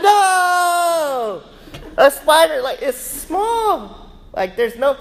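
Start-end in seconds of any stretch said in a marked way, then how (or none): 0.0-1.4 long, loud, high mock "no"; hammed-up cry of horror from the whole family; horrified family. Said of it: "And they're like". 2.0-4.1 high-pitched, drawn-out comic disbelief; incredulous dad. Retold or imitated. Dad incredulous at family's fear.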